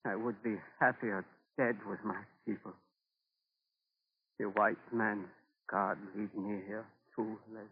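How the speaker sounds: quiet grieving voice; hushed despair. Acted. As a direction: let it sink to a defeated murmur. Despair after finding bones.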